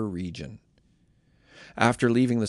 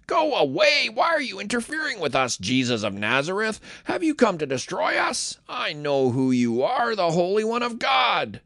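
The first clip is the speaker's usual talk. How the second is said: demon shouting; 8 semitones higher than usual. Acted. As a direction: shriek at Jesus like a cornered evil spirit. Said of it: "cried out, shouting".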